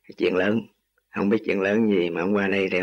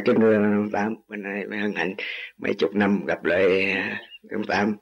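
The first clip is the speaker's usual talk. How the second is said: normal talking voice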